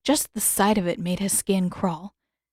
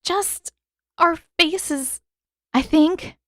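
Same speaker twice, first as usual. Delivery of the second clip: shaky reply; 9 semitones higher, much slower than usual. Acted. Katara shaken reply.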